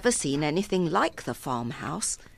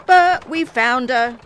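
loud, slow calling-out, raised pitch; shouting; 7 semitones higher, 10 dB louder than usual. A narrator's reading. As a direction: call out to the cart driver. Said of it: "he shouted".

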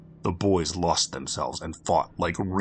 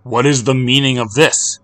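raised, indignant challenge; angry demand; 6 semitones higher, 8 dB louder than usual. Acted. A leader confronting a crowd of strange children, angry demand. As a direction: demand an answer, sharp and louder.

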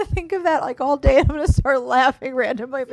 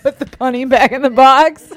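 a louder, hammed-up bad Southern accent; mock a movie star's terrible Southern accent, louder; lightly done fake Southern accent; Nick Cage bad Southern accent; 8 dB louder than usual. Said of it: "It was the worst Southern accent".

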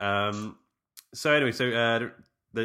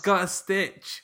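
mock toddler whine; 10 semitones higher, 5 dB louder, slower than usual.

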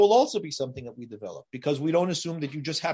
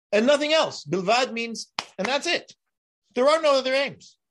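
higher and louder, insistent; emphatic insistence; 8 semitones higher, 4 dB louder than usual. Shown as a demonstration. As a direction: hammer it home, no other aim. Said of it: "that is as emphatic as you get".

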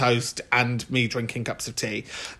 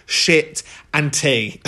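ordinary talk, nothing marked

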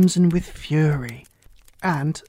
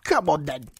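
restrained, growled royal roar. A narrator's reading. Queen summons Alice, understated roar. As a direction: order her along imperiously. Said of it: "roared the Queen".

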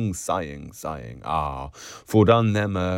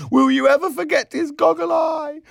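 very high, loud hilarious shriek; piercing girlish shout; 5 dB louder than usual. A narrator's reading. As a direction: scream it out through your glee. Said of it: "shrieking, Miss Kennedy cried".

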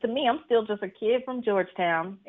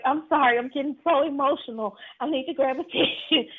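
faked tearful emotion; 6 semitones higher than usual. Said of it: "I pulled it off like I was being emotional".

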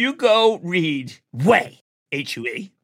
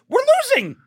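quoting Cronkite, pitched high; faster than usual. Retold or imitated. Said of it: "What he said was".

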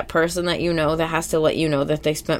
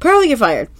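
high and louder declaration; mock dramatic firing; 9 semitones higher, 5 dB louder than usual. SpongeBob firing Pearl at the door, high and loud. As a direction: announce it with a flourish.

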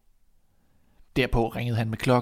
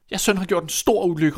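high, raised complaint; crying out; 6 semitones higher, 4 dB louder than usual. Acted. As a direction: cry out the accusation. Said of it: "råbte hun". (råbende anklage)

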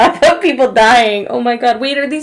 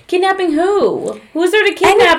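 incredulous question; 8 semitones higher than usual. Real, not acted. Disbelieving question.

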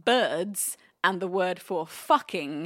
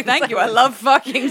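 laughing retelling; 7 dB louder than usual. Retold or imitated. Retold reply, laughing.

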